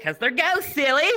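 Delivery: high, loud camp teasing; flamboyant tour guide. Retold or imitated. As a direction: imitate a guide playfully scolding.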